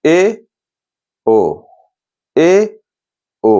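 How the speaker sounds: ए and ओ sounded out twice; teacher sounding ए and ओ for the class; half-closed vowel demo. Shown as a demonstration. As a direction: demonstrate ए then ओ slowly. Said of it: "देखिए".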